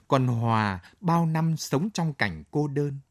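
usual speaking voice

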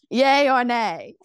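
loud, drawn-out YAY OR NAY; slow, playful proclaiming; hammed-up repeat. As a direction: stretch it out like a mock proclamation. Repeats poll slogan, hammed up.

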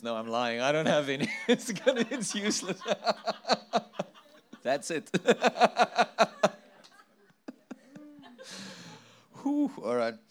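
giggling high through the words; laughing. Real, not acted. Laughing at own unprepared notes.

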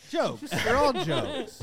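mock solemn. Echoing mock solemn line.

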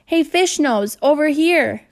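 loud, high call across the court. A narrator's reading. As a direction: shout for the ball from far off. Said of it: "called Robbie".

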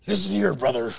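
low, lightly voiced wrestler growl; Hogan. Describing Hogan to co-hosts, low catchphrase impression. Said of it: "when you describe Hogan".

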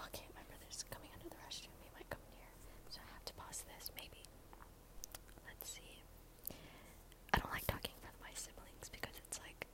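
hushed so the brother won't hear; whispering. Real, not acted. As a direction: whisper it, keep it secret. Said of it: "Ooh, A S M R".

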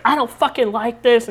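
high-pitched imitation of a freaked-out mother-in-law; mock freak-out. Mother-in-law hates the seats, mock freak-out. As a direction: pitch it up, imitating someone upset. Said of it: "she's freaking out".

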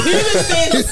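high, loud mimicked outburst; imitated ranting. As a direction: act out a fan yelling at the screen. Mimicking screaming pub fan, mocking.

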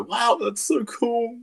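high, slow mock amazement; hammed-up wowed reaction; mock impressed. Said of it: "I don't really ever go".

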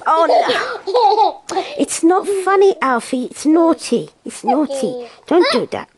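loud scolding through laughter; telling off, half laughing; mock scolding. Real, not acted. Scolding baby who spits food.

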